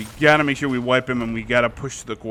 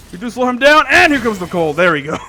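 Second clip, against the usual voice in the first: loud, high excited call; 9 semitones higher, 8 dB louder than usual. Real, not acted. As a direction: hype the play as it lands. Kill secured, excited call.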